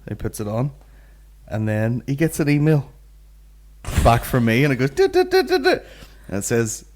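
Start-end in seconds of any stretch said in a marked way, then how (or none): 4.9-5.8 high electronic dit-dit beeps; high beeping noise. Retold or imitated. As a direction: chirp it like a notification. Imitated email beep, high.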